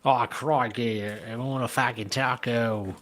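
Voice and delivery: slow, hammed-up Australian accent for a Brazilian. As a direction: do a drawn-out mock Aussie accent. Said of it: "a Brazilian with an Australian accent".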